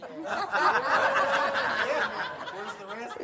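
silent wheezing laugh; quiet airy chuckle; breathy laugh. Real, not acted. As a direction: laugh without voice at the punchline. Laughing at his own joke.

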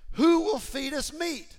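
high, loud, slow whiny plea; the people moaning for meat, mock whining; mock weeping.

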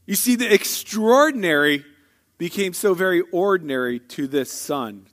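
ordinary talk, nothing marked

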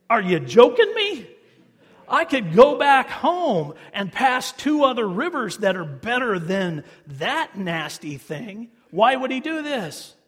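loud, high, laughing outrage at the order; outraged disbelief. Acted. Naaman scoffing at Elisha's order, loud and outraged.

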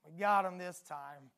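low, hushed villain's gloat; sinister low murmur of a commander; gloating king. Acted. Syrian king gloating over trapped prophet.

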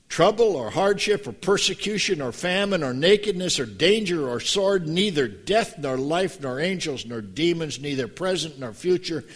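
loud rising recitation of Romans; fervent full-voice recitation; preached scripture. Acted. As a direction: thunder out the list.

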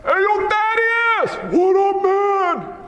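long, high holler between buddies; hammed-up street-style hailing, very high; disciples calling out. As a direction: shout across to a friend. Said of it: "They said like this".

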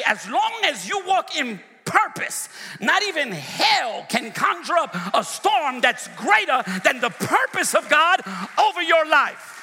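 high, loud rising sermon cry; preaching at full force. Real, not acted. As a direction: shout it out to lift the church. Preacher building to climax.

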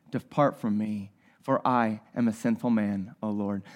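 low, soft, humbled plea; bow down and confess quietly; a broken man at Jesus' knees; hushed confession. Retold or imitated. Peter falls at Jesus' knees.

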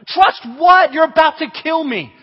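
loud, high incredulous retort; mock-outraged cry of disbelief; panicked outrage. Camper answering the hushed counselor, loud panicked outrage. Terrified kid snaps back at counselor.

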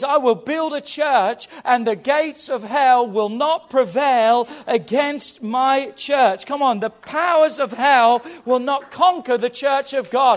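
loud, declaring sermon climax; full-force preaching. Real, not acted. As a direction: proclaim it boldly to the whole church.

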